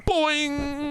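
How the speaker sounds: high, springy cartoon boing noise. Comic punctuating his story with a sound effect, high cartoon boing.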